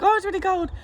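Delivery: high, loud gasp of shock; shocked yelp. Retold or imitated. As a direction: squeal it like the cold just hit. Acting out the first shock of cold.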